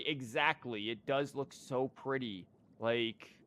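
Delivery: ordinary delivery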